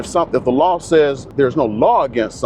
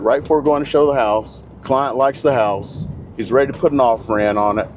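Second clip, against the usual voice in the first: ordinary talk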